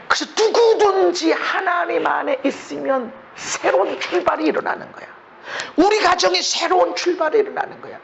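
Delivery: high, ringing preacher's proclamation; full-force preaching. Acted. Pastor rousing the congregation, high full-force preaching. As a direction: lift the room with a new beginning.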